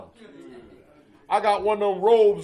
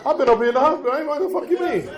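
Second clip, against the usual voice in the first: higher, put-on innocent protest; mock indignant denial; 7 semitones higher than usual. Addict denying drug use to the doctor, mock indignant. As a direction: play it as fake outrage.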